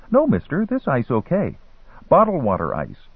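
high-pitched start, dropping to reassure; young street hustler reassuring. A narrator's reading.

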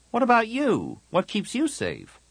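put-on very high girl's demand; worried challenge. Tahiri presses her friend about his safety, worried. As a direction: press him, voice pitched right up.

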